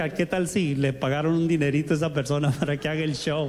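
desconfiado burlón. Retold or imitated. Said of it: "yo era así".

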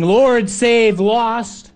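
mock yell. Disciple yelling back to Jesus, mock yell. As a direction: call it up the boat. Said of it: "yelled back to Jesus saying".

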